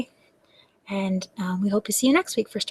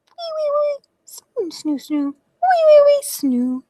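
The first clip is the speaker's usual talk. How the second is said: put-on whistle-snore like a sleeping baby; baby mummy snoring; slower than usual. Baby mummy asleep, snoring in high squeaks. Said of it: "That's how baby mummy sounds when he's sleeping".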